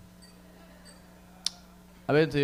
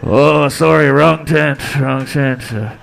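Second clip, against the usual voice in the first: loud, sheepish bear backing off; flustered bear; 10 dB louder, slower than usual. Pretend. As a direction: apologize fast and flee like a spooked bear. Bear retreating apologetically.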